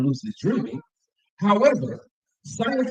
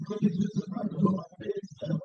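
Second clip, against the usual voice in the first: whispering; 10 dB quieter than usual. Real, not acted. Admiring the food aside.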